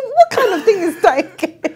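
slow, high and loud, laughing in disbelief; incredulous. Retold or imitated. Retelling the standby news to mom, loud laughing disbelief. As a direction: stretch it out like you can't believe it. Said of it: "I said".

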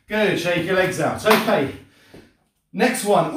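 ordinary talk

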